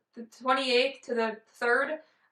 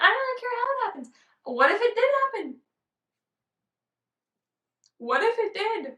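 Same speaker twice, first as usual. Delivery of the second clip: giddy wondering; 10 semitones higher, 3 dB louder than usual. Real, not acted. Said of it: "giddy musing".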